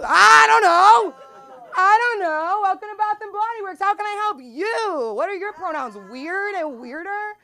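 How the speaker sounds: put-on high, loud panicky shop girl; flustered high clerk voice. Clerk panicking when a customer asks about pronouns.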